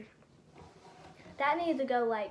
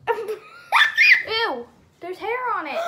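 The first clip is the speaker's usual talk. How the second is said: disgusted; 8 semitones higher, 10 dB louder, much slower than usual. Real, not acted. Disgust at hair on candy.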